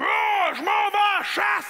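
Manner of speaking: loud, high roaring boast; drawn-out manly holler; macho caveman hunter. Acted. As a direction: bellow it like a primal man off to hunt. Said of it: "l'homme qui faisait comme".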